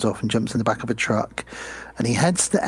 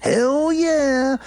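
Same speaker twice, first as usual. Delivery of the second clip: a much higher, louder whoop; eager hell yeah; 4 dB louder than usual. Retold or imitated. Director accepting the dinner invite with a high excited whoop. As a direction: jump at the offer. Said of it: "And he's like".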